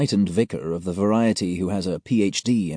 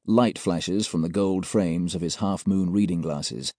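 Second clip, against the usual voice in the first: ordinary delivery, nothing marked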